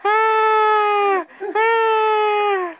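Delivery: very high, loud mock crying wail; squeaky helium wail. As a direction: wail like a disaster announcer on helium. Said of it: "really high-pitched squeaky voices".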